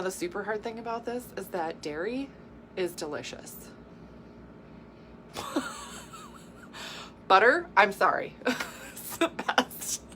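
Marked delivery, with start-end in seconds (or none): 5.4-10.0 high, helpless giggling through the words; real laughing fit. Real, not acted. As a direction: try to talk while cracking up. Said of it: "I'm laughing because I had".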